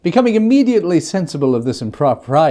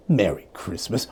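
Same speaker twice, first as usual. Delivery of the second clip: mocking scorn; 6 semitones lower, 4 dB quieter than usual. A narrator's reading. Scrooge mocks the phrase.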